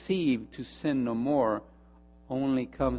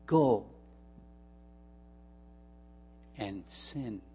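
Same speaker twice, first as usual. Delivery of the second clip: softer, slowing on go in peace; gentle sweet words; 10 dB quieter than usual. Acted. Jesus forgives the accused woman, softly and tenderly. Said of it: "sweet words".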